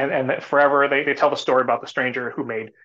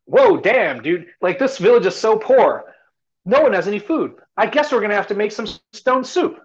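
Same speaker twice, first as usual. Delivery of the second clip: higher and louder, calling out to the village; loud proclamation; 7 semitones higher, 5 dB louder than usual. Retold or imitated. Stranger in the village center, calling out loudly. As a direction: announce it to the whole square. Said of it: "and he says loudly".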